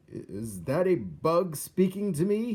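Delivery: slow, loud, puzzled king voice; a nearsighted king peering at the floor; squinting king. A narrator's reading. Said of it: "he asked".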